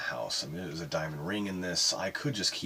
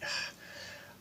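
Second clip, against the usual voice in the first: short, soft sigh; breathy sigh; 7 dB quieter than usual. Real, not acted. Smitten man recalling how much he liked her, soft sigh. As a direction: let out an airy sigh.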